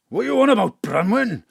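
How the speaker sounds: high loud scoff. Acted. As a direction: scoff loudly. Father mocks wife.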